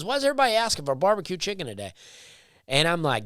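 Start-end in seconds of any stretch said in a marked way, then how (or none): 0.0-1.9 rushed, higher mock of the server. To the listener, mocking the barbecue server, high and fast. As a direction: rattle it off high and fast like a clueless waiter. Server quote mocked, sped up.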